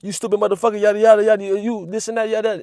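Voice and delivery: tossed-off imitation of a rant; a hint of yelling at a stranger; mock cussing out. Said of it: "cussing the motherfucker out".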